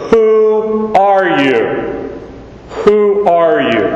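high, long drawn-out WHO in "Who are you?"; underscoring. Acted. Christ asks a third time, underscoring a high, slow WHO. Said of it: "it's like he underscores".

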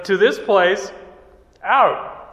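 loud call. Retold or imitated. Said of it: "a loud voice".

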